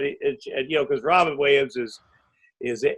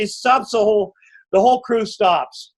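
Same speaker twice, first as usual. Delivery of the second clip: ordinary talk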